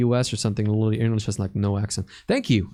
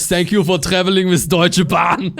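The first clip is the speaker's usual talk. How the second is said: louder, mock German accent in English; 4 semitones higher, 4 dB louder than usual. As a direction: announce it like a German train conductor. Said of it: "German people speaking English".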